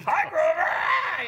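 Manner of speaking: very high, loud cartoon yelp; stage persona voice. Shown as a demonstration. Comic showing his act resembles the puppet, yelped high. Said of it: "it's really close".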